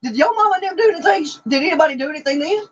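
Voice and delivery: shocked disbelief. Real, not acted. Shocked at abuse story.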